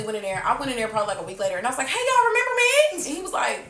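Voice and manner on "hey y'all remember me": high-pitched, playful hail; re-enacted cheery greeting. Woman returning to the store a week later, cheery greeting. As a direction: call out high as if greeting old friends.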